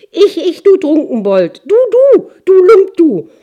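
shrill, very high furious shrieking; screaming king. Acted. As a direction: screech it in a rage. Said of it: "schrie der König".

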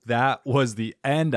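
normal talking voice

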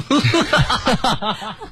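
rising high-pitched laugh. Real, not acted. Host laughs at the scary uncle, high laugh.

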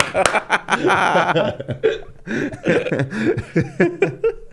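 high-pitched burst of laughter; high laughing fit. Real, not acted. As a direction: laugh helplessly. Laughing at twitch.

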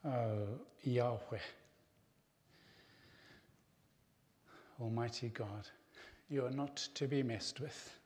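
soft, reverent praying voice with long pauses; prayer. Real, not acted. Preacher addressing Almighty God to close the sermon, hushed prayer. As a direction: pray softly, leaving space between phrases.

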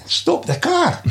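incredulous. Retold or imitated. Driver astonished by the trainer's demand on the bridge. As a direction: throw it back in disbelief.

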